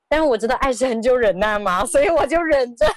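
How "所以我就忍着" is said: higher, breaking into laughter; laughing. Real, not acted. Wife admitting she was enduring it, laughing. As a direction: let a laugh take over the words. Laughs at her own forced patience.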